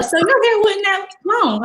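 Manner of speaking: high-pitched imitation of a teacher; nosy teacher. Teacher questioning a student about her hair, high mock-teacher voice.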